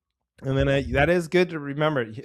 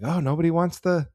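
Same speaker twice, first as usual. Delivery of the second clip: mock dismay; 8 semitones higher, 5 dB louder than usual. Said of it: "you're like".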